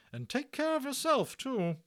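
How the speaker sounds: high loud brisk nurse voice; a bustling hospital matron; hearty Matron. Acted. Matron reassuring Omri about the Indian, high and hearty.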